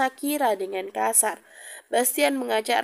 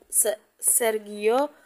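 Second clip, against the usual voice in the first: slow, halting, caught off guard; hesitant, startled stammering; much slower than usual. A narrator's reading. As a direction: stumble on the name, then falter with long pauses.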